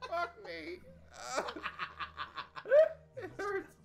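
high laughing wheeze; pained laugh through hiccups. Real, not acted.

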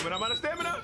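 high panicked cry. Real, not acted. Chased, lights red.

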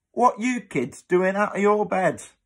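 high, loud hammed-up demand; old cleaner catching children out of bed. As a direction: bark at the runaway kids. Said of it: "demanded the cleaner".

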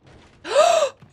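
a shrill, sudden gasp of fright; startled high gasp; sharp gasp. Real, not acted. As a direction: gasp as if something lunged at you. Jump scare in horror game.

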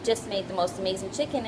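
usual speaking voice

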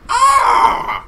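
very high, loud scream; triumphant scream. Real, not acted. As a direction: let out a huge shriek. Celebration scream after win.

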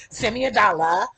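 loud mimicked catchphrase; loud, playful quote of her; imitating her plea. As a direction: belt it out as her request. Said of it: "'cause she's like".

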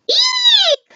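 very high, long squeal; mock-scared squeal, way up high; frightened eek. A narrator's reading. Train on the high dive, very high mock squeal.

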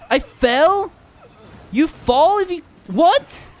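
high loud shocked cry; shocked disbelief. Real, not acted. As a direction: squeal it in disbelief. Shocked at falling.

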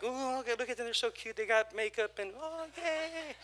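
high, breathy-free mock cute gushing; mocking cutesy voice. Preacher mocking shallow cute Christians, high mock gushing. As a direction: lightly imitate shallow cute churchgoers. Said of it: "We weren't being cute".

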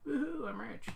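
high mock cheer, then flat Not; sarcastic cheer. Real, not acted. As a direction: fake excitement that drops. Sarcastic about fifteen bucks.

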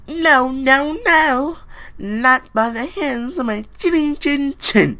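high, loud little pig voice. Acted. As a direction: squeal it like a frightened little pig. Little pig answering the wolf.

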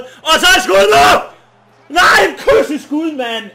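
slow, high, very loud yell; real shouting. Real, not acted. Gamer taking a shot at goal, excited shouting. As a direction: shout through the shot and the miss. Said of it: "Jeg råber sgu lidt".

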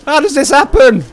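high, loud, worked-up yelling; unhinged outcry. Real, not acted. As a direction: cry out as if losing your mind. Says he's going off rocker.

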